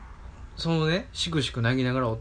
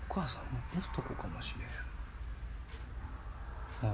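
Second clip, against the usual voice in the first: 息だけで囁くように言う; 秘密を打ち明けるようにそっと; ひそひそ声; 15 dB quieter than usual. Retold or imitated. 母の告白を小声で再現. (母の告白を真似たささやき声)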